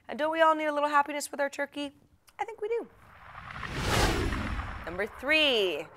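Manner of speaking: ordinary delivery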